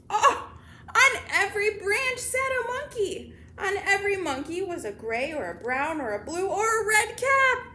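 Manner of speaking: high, loud storybook astonishment; amazed reveal. A narrator's reading. Reader reveals monkeys in the tree, amazed. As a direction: gasp out the big surprise to young listeners. Monkeys discovered wearing the caps.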